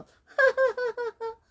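high teasing cackle. Retold or imitated. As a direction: laugh like a careless kid. Imitating own laughter.

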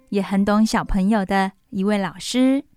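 usual speaking voice

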